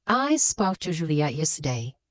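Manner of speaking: "I" stressed at the front, "spoke to Julia yesterday" falling away. Shown as a demonstration. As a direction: lean on "I" to show who spoke. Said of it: "I emphasize that it's me who spoke".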